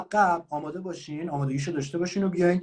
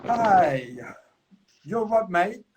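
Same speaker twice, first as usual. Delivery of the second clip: higher British slang greeting; 4 semitones higher, much slower than usual. Shown as a demonstration. Teacher tests the student with a British greeting. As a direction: throw it at the student like a London cop. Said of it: "شنیدی من گفتم".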